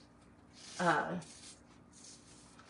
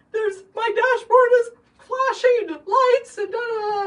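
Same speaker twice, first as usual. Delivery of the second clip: much higher, louder, slower tearful wail; retold crying panic; 12 dB louder than usual. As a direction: wail it like you're crying at the wheel. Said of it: "and I'm crying".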